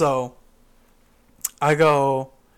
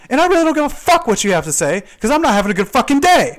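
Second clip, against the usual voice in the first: loud, high defiance; angry retort; 9 semitones higher, 8 dB louder than usual. Retold or imitated. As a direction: fire back at a coworker, raised voice. Acting out the same argument.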